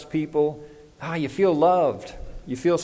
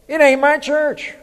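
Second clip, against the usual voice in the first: emphatic denial; 12 semitones higher, 6 dB louder than usual. Real, not acted. Preacher rejecting "my church" talk.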